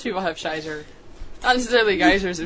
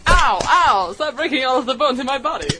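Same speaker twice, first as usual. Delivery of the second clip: mock pain cries; 4 semitones higher, 4 dB louder than usual. Mock sound-effect victim.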